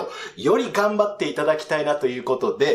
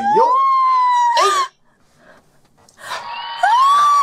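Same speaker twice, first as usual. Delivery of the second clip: high piercing muscle shout; 6 dB louder than usual. Acted. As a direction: yell it out high. Comedian's signature muscle shout.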